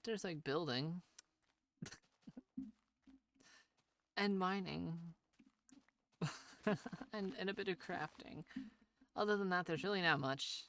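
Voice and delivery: excited and loud, laughing between. Real, not acted. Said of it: "it got really loud".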